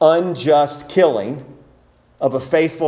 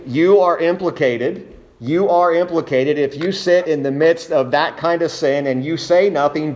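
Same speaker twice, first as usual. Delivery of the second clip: usual speaking voice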